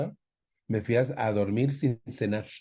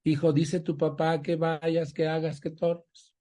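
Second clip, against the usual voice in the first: mother relaying; 6 semitones higher, slower than usual. Retold or imitated. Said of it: "Y ahí viene mi mamá".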